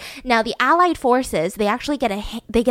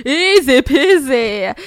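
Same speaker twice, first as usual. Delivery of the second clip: laughing; 5 semitones higher, 7 dB louder, slower than usual. Pretend. Mocking the king's boast.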